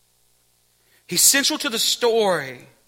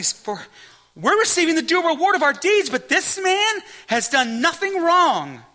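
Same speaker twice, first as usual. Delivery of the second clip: rebuking thief; 6 semitones higher than usual. A narrator's reading. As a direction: rebuke him, rising in urgency. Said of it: "rebuked him".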